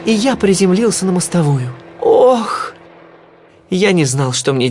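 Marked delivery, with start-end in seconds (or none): 2.0-2.7 acted "oof" of a hard landing; pained groan. A narrator's reading. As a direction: groan as if hitting the ground. Narrator lands on the pavement.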